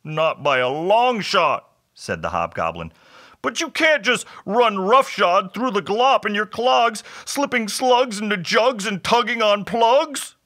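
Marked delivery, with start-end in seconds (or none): 0.0-1.7 slow, raised creature voice; drawn-out, mildly whiny reply; disagreeing hobgoblin. A narrator's reading. 3.4-10.4 high, whiny creature voice; scolding hobgoblin. A narrator's reading.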